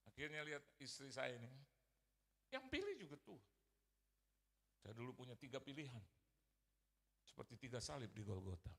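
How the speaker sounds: ordinary talk, nothing marked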